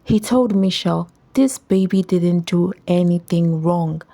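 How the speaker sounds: plain reading voice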